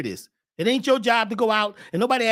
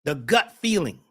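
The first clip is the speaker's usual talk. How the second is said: ordinary delivery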